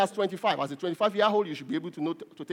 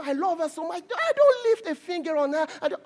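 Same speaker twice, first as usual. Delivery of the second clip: high-pitched, indignant mother; imitated protesting mother; 8 semitones higher than usual. Mother insisting to doctor she never hits, high protesting. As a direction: plead innocence in a high imitated voice.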